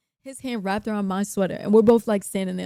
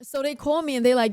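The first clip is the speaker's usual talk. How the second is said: ordinary talk, nothing marked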